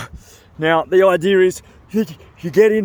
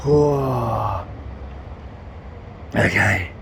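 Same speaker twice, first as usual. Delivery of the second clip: breathy cold-shock gasps; short soft gasps in icy water; gasping from cold; 4 semitones lower, 6 dB quieter, much slower than usual. Real, not acted.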